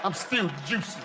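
mock-sultry boast; vain older woman. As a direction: preen like a sixty-year-old in juicy pants. Older woman in juicy pants.

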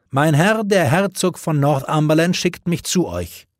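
high, loud arrogant announcement; haughty herald. Acted. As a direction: proclaim it with disdain for the king. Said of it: "verkündete der Herold hochmütig".

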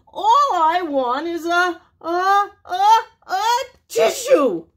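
high, loud, tearful build to a sneeze; crying wolf about to sneeze. Acted. Sick wolf wails for a tissue, building to a sneeze. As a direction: wail it as the sneeze comes.

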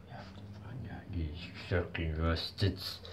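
hushed, muttered curse; very soft, whispery grumble; whispered aside. Acted. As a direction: swear under your breath. Muttered curse after the question.

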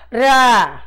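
"Ra." with a scraped R in the throat; rasping R sound. Shown as a demonstration. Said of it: "Ele dá um, um arranhãozinho na, na voz". ("Ra." com o R arranhado na garganta)

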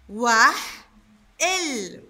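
"وح" and "ال" said apart; teacher splitting the word into syllables; syllable split. Shown as a demonstration. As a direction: break "وحل" into "وح" and "ال". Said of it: "وهنا قطعناها".

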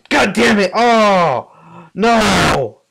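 loud, high furious yell of dismay; frustrated shout. Real, not acted. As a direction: explode in real frustration. Loses a cow, frustrated outburst.

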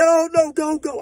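imitating a frantic girlfriend; panicked hollering. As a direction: push him to go now. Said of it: "she crying and hollering".

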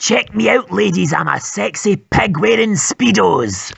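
ordinary delivery

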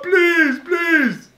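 extremely high mock wail; begging for life. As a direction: plead desperately in a hammed-up crying squeal. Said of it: "Begging for my life".

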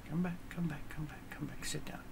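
soft calling-back that fades into a whisper; coaxing whisper. Pretend. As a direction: coax them back in a hush. Calling offended people back.

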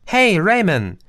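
loud, high happy call. A narrator's reading. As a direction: shout his name across the stop. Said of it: "rief sie". (freudig und laut nach Raymond rufen)

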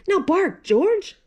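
coaxing high call to bark; mother prompting George again, high; urging mother. Acted. Mother dog urging George to bark, coaxing.

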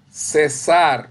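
teacher's emphatic ce-SAR for "Cesar"; stress on last syllable. Shown as a demonstration. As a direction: hit the final syllable of "Cesar". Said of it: "no César, no el nombre".

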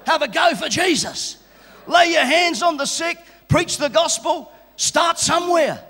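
rallying preaching. Real, not acted. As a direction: fire up the congregation. Crowd call and response.

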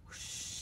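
breathy wind whoosh; blowing wind sound. Pretend. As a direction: blow softly like autumn wind. Imitates the autumn wind blowing.

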